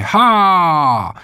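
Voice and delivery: long, high "haaa" sliding down; piercing howl. A narrator's reading. Boy showing how corsairs shriek, piercing howl. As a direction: let out a wild, piercing pirate howl. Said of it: "wydał z siebie przenikliwe wycie".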